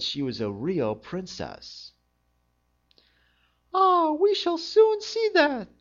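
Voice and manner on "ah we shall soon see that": very high, loud put-on queen voice; a sly, knowing royal voice; old queen mother. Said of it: "thought the old queen mother".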